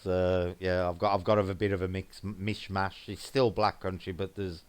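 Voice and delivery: ordinary talk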